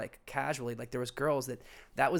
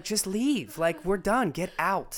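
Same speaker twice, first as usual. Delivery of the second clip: impatient; 7 semitones higher, 4 dB louder, slower than usual. Retold or imitated. Acted dismissal of the girl.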